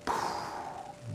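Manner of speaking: long, soft exploding exhale; mind-blown puff. Pretend. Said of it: "your mind will be like".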